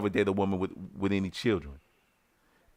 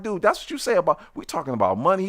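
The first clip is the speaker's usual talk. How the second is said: much higher, outraged "dude"; incredulous anger; 7 semitones higher, faster than usual. Retold or imitated. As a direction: rebuke him in disbelief. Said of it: "I'm like".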